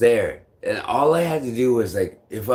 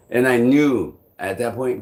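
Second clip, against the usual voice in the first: normal talking voice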